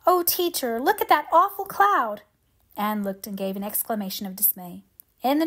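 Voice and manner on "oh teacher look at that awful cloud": high, scared cry of alarm; a little girl gasping out in fright; frightened child. A narrator's reading. Schoolgirl Annetta clutching her teacher's hand, frightened high cry. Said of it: "caught her hand nervously".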